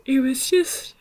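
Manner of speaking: words taken over by a yawn, high; high-pitched yawn-talk. Real, not acted.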